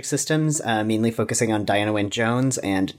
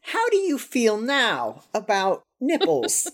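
high, slow dramatic questioning; mock interrogator; 10 semitones higher, slower than usual. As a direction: grill him like a confessor.

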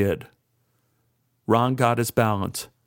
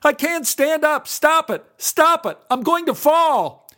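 panicked; 4 dB louder than usual. A narrator's reading. Ron being pulled, panicking.

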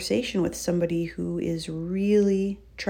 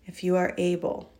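soft spa whisper; 5 dB quieter than usual. Acted. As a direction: guide them gently into the breath. Leads a breathing exercise.

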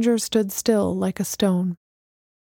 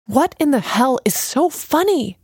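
loud, higher, indignant; angry demand; 5 semitones higher, 4 dB louder than usual. A narrator's reading. Alana demanding why Messenger laughs, angry. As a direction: snap at her for laughing. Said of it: "she demanded".

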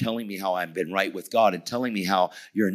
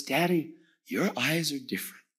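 put-on higher-pitched little girl voice; young daughter; 6 semitones higher than usual. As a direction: imitate a child marveling at daddy.

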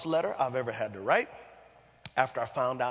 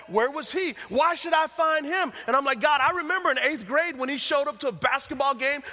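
much louder, higher, furious rant; angry tantrum; 10 semitones higher, 7 dB louder than usual. Retold or imitated. Son raging at God about his absent father, shouting. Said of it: "a little temper tantrum".

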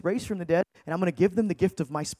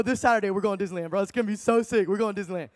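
usual speaking voice

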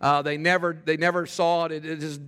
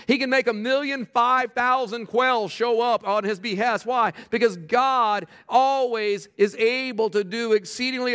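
raised, driving sermon delivery; emphatic preaching; 4 semitones higher than usual. Real, not acted. Pastor assures the church that God provides, emphatic preaching. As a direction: preach it with rising conviction, higher in pitch.

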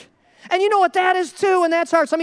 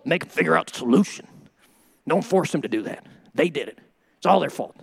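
much lower, unyielding verdict; stern hard-liner; 11 semitones lower than usual. Acted. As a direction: lay down blame in a low voice. Voices hard-line right on loans.

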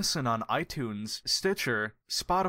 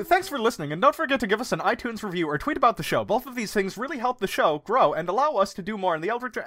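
fast, higher rushed ad read; 6 semitones higher, 4 dB louder, faster than usual. Retold or imitated. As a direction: rattle it off a mile a minute. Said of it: "trying to go a mile a minute".

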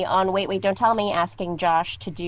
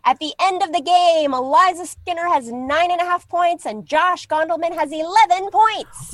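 excited announcement; 8 semitones higher, 5 dB louder than usual. Real, not acted. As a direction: announce the winner with rising excitement. Announcing the game's final score.

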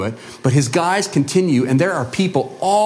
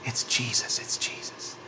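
hushed crowd whisper, breath only; an awed, breathy murmur passed along; 4 semitones lower, 13 dB quieter than usual. Acted. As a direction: whisper it in awe to a neighbour. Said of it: "you hear people whisper to one another".

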